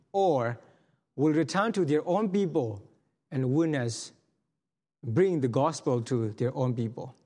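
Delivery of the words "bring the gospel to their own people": ordinary talk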